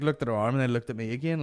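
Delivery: ordinary delivery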